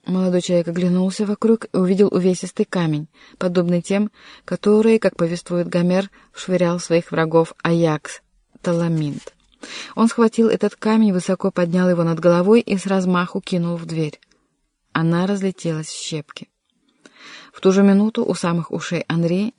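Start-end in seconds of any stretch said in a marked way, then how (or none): none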